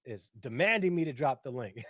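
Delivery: usual speaking voice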